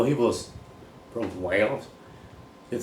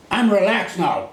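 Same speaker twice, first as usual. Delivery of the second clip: mock student; 12 semitones higher, 8 dB louder, slower than usual. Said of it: "people say".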